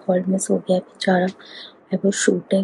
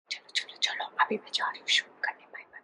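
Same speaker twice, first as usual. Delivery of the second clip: hushed whisper so nobody wakes; late-night whisper; 11 dB quieter, much faster than usual. Real, not acted. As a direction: whisper the goodbye softly. Late night, family asleep.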